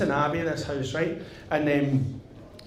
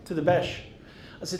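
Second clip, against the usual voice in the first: usual speaking voice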